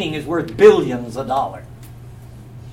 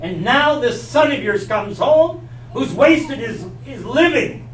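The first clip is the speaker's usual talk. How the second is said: resentful complaint; 6 semitones higher, 5 dB louder than usual. Acted. Older brother's angry complaint.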